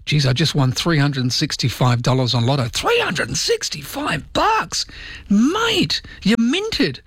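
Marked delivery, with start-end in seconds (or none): 2.7-7.0 very high, drawn-out mock astonishment; hammed-up Aussie amazement, squeaking upward; amazed reaction. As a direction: marvel at a mate's windfall. Acted amazed reply to Lotto win.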